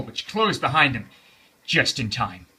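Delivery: plain reading voice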